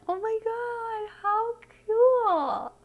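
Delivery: very high delighted squeal, slow; excited squeal. Real, not acted. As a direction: squeal with joy at the card. Squeals over the Hangman card.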